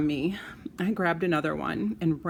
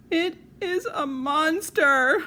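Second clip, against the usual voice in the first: high, slow sobbing wail; bawling; 10 semitones higher, 6 dB louder, slower than usual. Acted. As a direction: cry it out through tears. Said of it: "I bawled".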